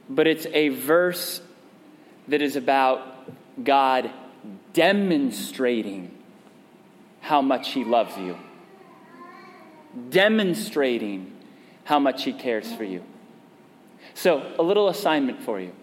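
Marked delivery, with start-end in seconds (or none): none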